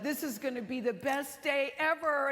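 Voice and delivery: excited student. Retold or imitated. Student guide promising a great day, high excited quote. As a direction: gush like a thrilled student. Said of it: "And she said".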